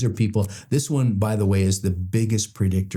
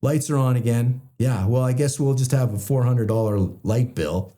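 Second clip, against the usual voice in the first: higher, dry mocking voice; sarcastic; 4 semitones higher than usual. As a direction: drip sarcasm, pitched up and changed.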